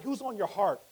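ordinary talk, nothing marked

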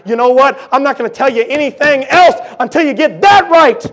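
loud, high, pressing sermon voice; preaching at full force. Acted. Preacher voicing a sinner and the Spirit, full force. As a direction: push every line out to the pews.

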